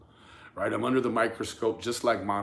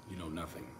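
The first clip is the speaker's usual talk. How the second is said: quiet; 6 semitones lower, 15 dB quieter, faster than usual. Real, not acted. Repeated, dropped to near silence.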